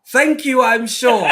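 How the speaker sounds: laughing, hammed-up British; mock British accent. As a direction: lay the posh manners on thick. Loud laughing British echo.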